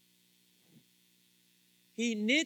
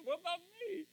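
high-pitched, softer plea through laughter; mock plaintive; 10 semitones higher, 5 dB quieter than usual. Listener wanting a compliment too, imitated in a high plaintive voice. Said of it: "He goes".

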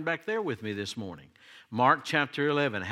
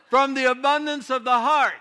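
much higher, louder proclamation; preaching; 11 semitones higher, 7 dB louder than usual. Acted. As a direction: belt the verse out at full force. Preacher restarts verse fully.

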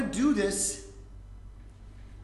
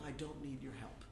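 low, much softer cold refusal; cold quiet; 5 semitones lower, 16 dB quieter than usual. Acted. Man shutting down his mocking brother, low and cold.

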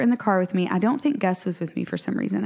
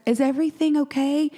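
concerned ask; 7 semitones higher, faster than usual. Retold or imitated. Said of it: "I was like".

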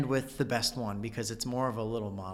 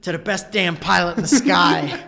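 louder, raised toast; Walken toast; 6 semitones higher, 10 dB louder than usual. Retold or imitated. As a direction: lift the glass and call it out. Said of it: "that's my Chris Walken moment".

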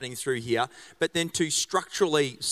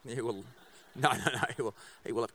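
normal talking voice

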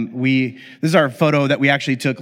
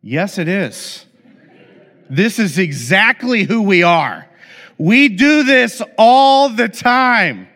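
slow defiant retort building louder and higher; smart-aleck comeback; 3 semitones higher, 4 dB louder, slower than usual. Retold or imitated. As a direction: talk back cheekily. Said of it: "smart alecks".

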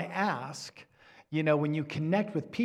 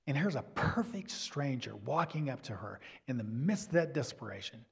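normal talking voice

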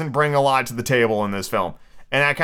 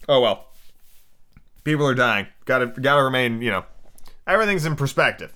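normal talking voice

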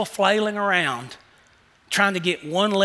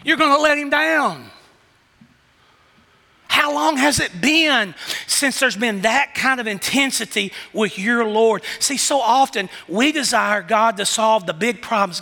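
ordinary talk

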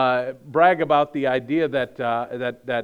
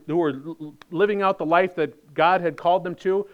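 normal talking voice

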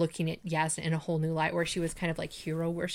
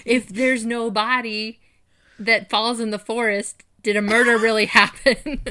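mock profound; 4 semitones higher, 8 dB louder than usual.

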